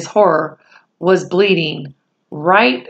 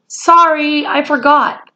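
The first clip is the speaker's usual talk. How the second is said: higher, lightly voiced boy apology; sheepish boy; 8 semitones higher than usual. A narrator's reading. Boy apologising to his mother, higher and sheepish. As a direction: say sorry like a caught kid.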